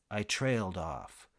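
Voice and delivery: ordinary delivery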